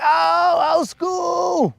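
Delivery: high hammed-up yell; mock excited shout. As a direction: yell it like a hyped-up friend. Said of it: "You'll be like".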